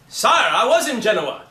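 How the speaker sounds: loud, slow stage declamation; declaiming a line. Shown as a demonstration. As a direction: proclaim it like a herald to a king. Actor demos a script line.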